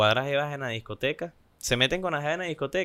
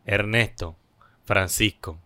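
slow, much lower man's voice; mock deep introduction; 6 semitones lower, slower than usual. A club stranger introducing themselves, slow low mock man's voice. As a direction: drop the voice low and slow for the reveal.

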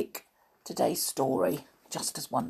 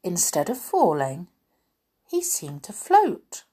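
plain reading voice

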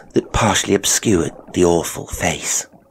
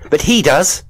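high, loud insistence; insistent; 10 semitones higher, 6 dB louder than usual. Acted. Wife pointing at the boy, insistent.

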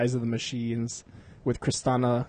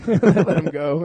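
usual speaking voice

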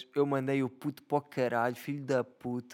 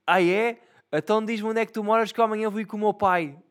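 imitating the kid; 7 semitones higher, 5 dB louder than usual. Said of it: "o puto disse-me".

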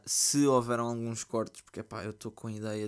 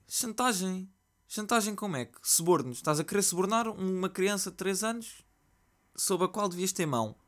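higher, louder indignant rant; outraged disbelief; 8 semitones higher, 4 dB louder than usual. Real, not acted. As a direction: scold her in disbelief.

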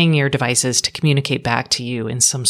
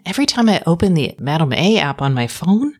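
ordinary talk

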